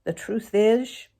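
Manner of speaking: plain reading voice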